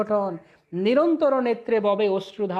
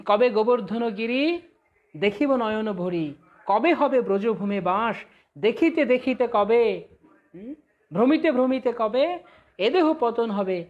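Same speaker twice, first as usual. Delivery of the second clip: slow, chanted verse; devotional recitation; 2 semitones higher, 3 dB louder, slower than usual. Acted. Preacher reciting Bhaktivinoda's hymn, slow and chanted. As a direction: intone the hymn lines slowly.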